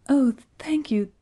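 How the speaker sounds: high, loud burst of thanks; grateful cry. A narrator's reading. Jane thanking the moth, crying out high and loud. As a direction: cry out in delighted relief. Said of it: "cried Jane".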